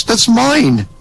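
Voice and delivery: high, smug reveal; smug punchline. Acted. God boasting to Brett about his mansion, high smug. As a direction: toss off the boast.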